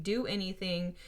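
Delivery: normal talking voice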